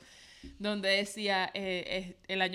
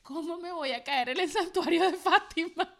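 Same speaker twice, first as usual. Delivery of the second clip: high, indignant disbelief; exasperated mock outrage; 6 semitones higher, 3 dB louder than usual. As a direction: complain to God in mock disbelief. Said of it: "porque decía". (indignación fingida, aguda)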